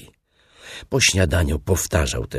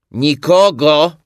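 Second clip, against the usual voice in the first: loud, high, drawn-out denial; high, slow holler; shouted reply; 13 semitones higher, 12 dB louder, slower than usual. A narrator's reading. Hidden Rabbit answers from inside.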